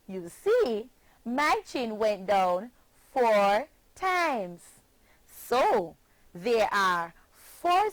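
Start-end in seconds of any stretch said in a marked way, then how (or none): none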